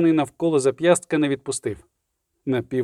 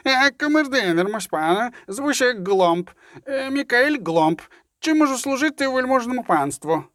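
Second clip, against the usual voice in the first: squeaky servant; 8 semitones higher than usual. A narrator's reading. Lawyer posing as his own dim-witted valet, squeakily. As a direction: put on a squeaky, halting lackey voice. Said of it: "змінив голос на трохи писклявий".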